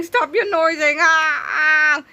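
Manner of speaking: high crying alien whine; pained alien wail. Acted. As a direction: wail in pain at the noise.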